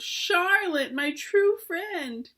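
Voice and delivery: high, drawn-out moan of grief. A narrator's reading. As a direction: moan her name in sorrow. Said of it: "he moaned".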